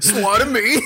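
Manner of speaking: laughing through a gruff Batman voice; Batman growl with a laugh. Retold or imitated. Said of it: "His, his Welsh accent".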